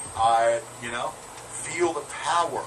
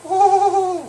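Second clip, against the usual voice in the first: high ooh; 14 dB louder than usual. Acted. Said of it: "And I go".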